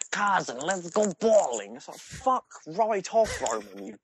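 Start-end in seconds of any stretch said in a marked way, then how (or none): 0.1-1.7 high, slow, drawn-out caller; hammed-up cousin voice, stretching every word; Roman. Cousin phoning to invite him bowling, high slow mock voice. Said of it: "he's like". 2.0-4.0 high, slow, drawn-out rant; hammed-up fed-up dismissal, pitched way up; mock exasperation. Player telling pestering cousin to go away, mock exasperated. Acted exasperated reply to caller.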